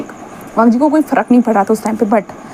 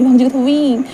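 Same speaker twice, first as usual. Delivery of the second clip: plain reading voice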